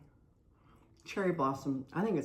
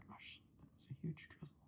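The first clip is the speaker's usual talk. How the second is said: whisper; 18 dB quieter than usual. Real, not acted. Reacting to big icing drizzle.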